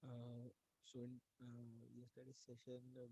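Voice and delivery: very low, faint voice; mic volume far too low; barely audible. Real, not acted. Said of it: "your voice is very low".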